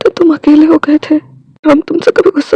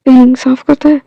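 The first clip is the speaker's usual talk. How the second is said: ordinary delivery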